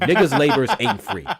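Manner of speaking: loud repeat, laughing through it; laughing shout. Real, not acted. Streamer repeats the joke to chat, loud and laughing. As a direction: yell it again mid-laugh.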